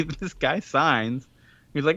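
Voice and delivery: ordinary delivery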